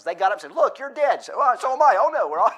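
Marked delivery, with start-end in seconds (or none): 0.5-1.2 mock surprise. Acted corpses waking up, comic. 1.4-2.5 high, loud comic alarm; higher and louder, hammed-up panic; mock alarm. Acted corpses waking up, comic.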